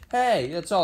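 ordinary talk, nothing marked

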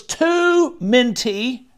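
"too" high and drawn out; complaining stress. A narrator's reading. Child complaining.

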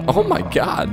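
ordinary talk

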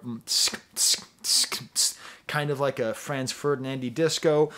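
sharp, spaced tsk cymbal noises; imitated hi-hat. Showing the drummer's part, mouthed hi-hat hits. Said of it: "does the hi-hat on the twos and the four".

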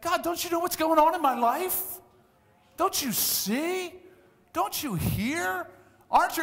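high, drawn-out pleading to God; pleading complaint. Retold or imitated. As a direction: complain to God like you feel forgotten. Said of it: "why are you saying".

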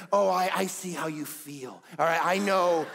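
a hint of a patronizing father soothing his kid; mock understanding dad.

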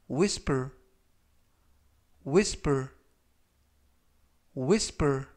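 whisper, clear and falling; teacher says whisper slowly and distinctly; model pronunciation. Shown as a demonstration. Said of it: "이라고 발음하시면 되겠고요".

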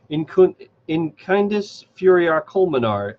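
queen casting a spell on the orcs, slow incantation. A narrator's reading. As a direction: intone "In kindis furia culminar" slowly like an ancient spell. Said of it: "spoke words she did not know".

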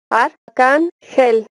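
teacher breaking a word into syllables. Shown as a demonstration. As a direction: separate each syllable with a small gap. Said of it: "dividir en sílabas las palabras".

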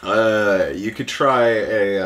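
ordinary talk, nothing marked

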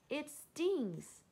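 high, stung outcry; pained complaint. Acted. Elephant with a burning trunk, pained complaint. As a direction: yelp it in storybook pain.

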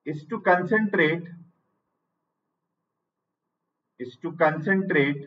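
each phrase said very slowly and spaced out for students to write down; teacher dictating notes at writing speed; slow dictation. Shown as a demonstration.

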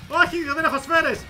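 real dismayed outburst; alarmed protest. Real, not acted. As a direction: shout it as things go wrong. Runs out of ammo mid-fight.

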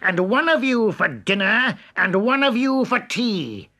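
high, loud furious wolf; angry wolf shout. A narrator's reading. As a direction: roar the threat at them. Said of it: "he shouted".

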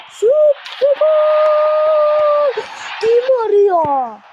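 very high, long drawn-out goal scream; goal shout. Acted. Goal call in commentary.

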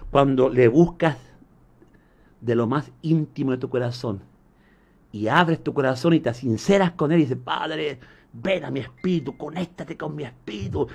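soft, low, devotional murmur; intimate prayer. Acted. Preacher guiding listeners to open their hearts to God, intimate prayer. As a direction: lead them gently into prayer, hushed and low.

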